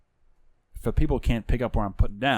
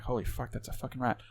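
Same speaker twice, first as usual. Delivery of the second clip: hushed shock; 12 dB quieter than usual. Retold or imitated. Seeing the rat, hushed shock.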